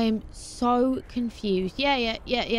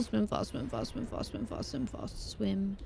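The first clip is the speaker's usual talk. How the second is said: hushed urging; 10 dB quieter than usual. Real, not acted. Urging himself on in water.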